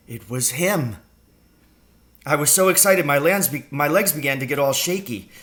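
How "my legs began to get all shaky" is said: plain reading voice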